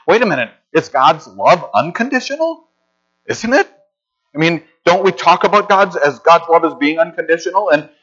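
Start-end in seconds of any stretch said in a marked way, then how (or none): 0.1-6.2 higher-pitched, questioning pushback; puzzled objection. Acted. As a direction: voice a listener who stops to challenge the claim. Voices a puzzled listener's objection.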